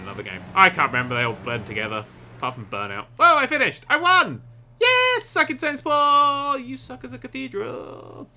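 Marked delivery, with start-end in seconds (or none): 3.2-5.2 high, loud cheer; triumphant. Real, not acted. Winning the race. 5.2-8.3 slow, high gloating; mock taunt. Taunting the cathedral.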